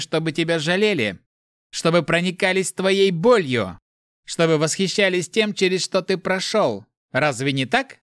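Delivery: high, relentless jeering; mocking pity. A narrator's reading. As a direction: taunt with sham sympathy. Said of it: "Голос стал издевательски мягким".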